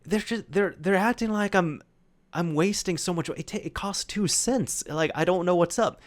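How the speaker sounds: a little upset. Retold or imitated. Friend back at the table venting about the counter staff, a little upset. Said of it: "looks a little bit upset".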